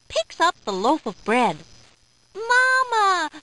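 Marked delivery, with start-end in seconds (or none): 2.3-3.3 high, loud doll voice; talking doll. A narrator's reading. Bread seems to call out to the policeman, talking doll voice. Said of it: "the bread says".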